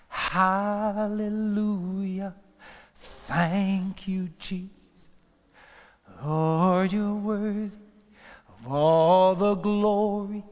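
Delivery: slow, drawn-out worship cry; praise cry. Acted. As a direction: lift it up in praise. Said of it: "makes me want to shout".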